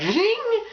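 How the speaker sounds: high-pitched "zing" effect; horror-movie sting. Retold or imitated. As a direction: imitate a sudden horror sting.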